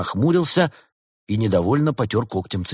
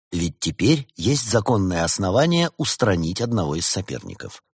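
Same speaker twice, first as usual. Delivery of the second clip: plain reading voice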